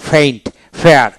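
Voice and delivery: "ফেইন্ট, ফেয়ার" with F-like P sounds; aspirated P. Shown as a demonstration. Teacher showing learners phonetic paint and pair, P said as F. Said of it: "এইভাবে আপনার উচ্চারণ করতে হবে".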